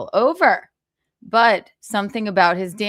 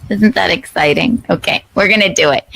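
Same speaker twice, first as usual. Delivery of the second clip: ordinary delivery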